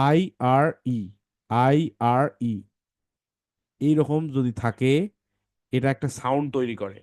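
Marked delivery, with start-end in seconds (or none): 0.1-2.5 slow spelling out. Shown as a demonstration. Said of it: "এই যে দেখো".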